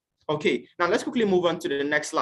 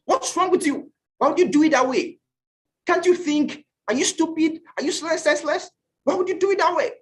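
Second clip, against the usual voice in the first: mock furious scolding, pitched way up; imitating a shouting classmate; 10 semitones higher than usual. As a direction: bark the questions at the class like an angry rep. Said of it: "and he shouts".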